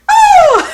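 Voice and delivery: loud, very high-pitched scream; re-enacted cry of disgust; shocked shriek. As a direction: shriek as if suddenly drenched. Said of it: "then I was like".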